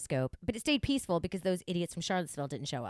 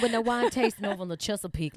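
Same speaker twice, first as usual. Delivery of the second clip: usual speaking voice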